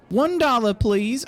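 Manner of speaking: high newsboy voice; paper boy. Acted. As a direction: ask for payment as a little paper boy. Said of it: "Talk to the paper boy".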